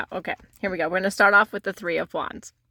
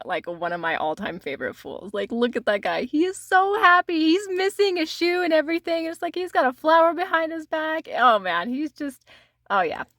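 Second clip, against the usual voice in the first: usual speaking voice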